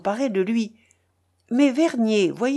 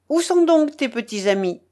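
higher, raised-voice question; crying out; 6 semitones higher, 4 dB louder than usual. A narrator's reading. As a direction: call the question across to a companion. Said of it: "s'écria-t-il". (question lancée d'une voix haute)

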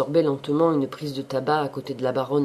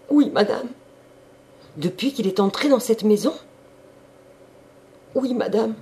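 higher, louder maid's voice; maid answering; 7 semitones higher, 5 dB louder than usual. A narrator's reading. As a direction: answer the mistress meekly but up high. Maid answering her mistress.

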